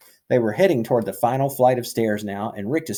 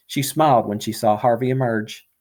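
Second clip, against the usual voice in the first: usual speaking voice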